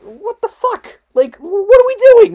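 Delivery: sudden high, loud disbelief; exasperated yelp of real anger; real outburst. Real, not acted. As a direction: blow up in real frustration at the league. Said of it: "I'm really mad at the N F L".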